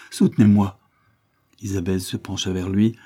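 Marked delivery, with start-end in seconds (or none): 0.1-0.8 supplique d'un enfant qui défaille, plus fort; un appel gémi au bord de l'évanouissement; faible gémissement. Acted. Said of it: "gémit l'enfant qui se sentait s'évanouir". (l'enfant malade supplie madame Vine, gémissement plus fort)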